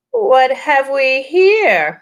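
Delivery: high, slow, menacing witch; cold queen voice drawn out. Acted. Witch voice in read-aloud story.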